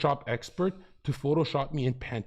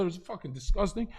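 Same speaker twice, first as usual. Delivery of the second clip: ordinary talk, nothing marked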